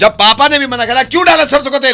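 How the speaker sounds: angry shout. Acted. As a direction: shout it at the wife in rising anger. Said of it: "अरे क्यों चिल्ला रहे हो?". (पत्नी पर चिल्लाते हुए)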